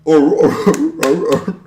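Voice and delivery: loud barking seal noises; seal impression. Friend mocks his American impression as a seal, loud barks. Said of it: "just a seal".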